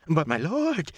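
high pleading protest. Acted. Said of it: "Quayle protested".

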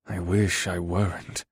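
slow, hushed admission; dark, grave. A narrator's reading. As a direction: say it darkly, slow and soft. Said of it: "said with a dark expression".

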